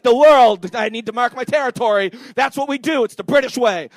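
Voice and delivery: loud, hammed-up territorial proclamation; mock British bravado. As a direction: declare it like a boastful mock manifesto. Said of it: "you're like".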